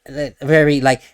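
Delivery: ordinary talk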